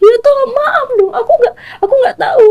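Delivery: high, tearful screaming for help; wife screaming apologies while being attacked; screaming plea. Retold or imitated. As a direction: cry out begging forgiveness. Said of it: "Saya teriak lah".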